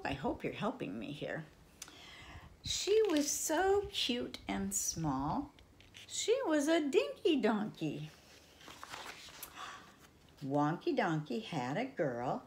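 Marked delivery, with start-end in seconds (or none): 2.6-8.1 story reading. Acted. Reading the picture book aloud.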